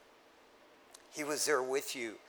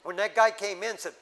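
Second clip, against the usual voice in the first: normal talking voice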